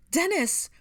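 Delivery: very high, loud cry; frantic high-pitched call; mother's shout. A narrator's reading. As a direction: shout for your son. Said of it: "his mother shouted".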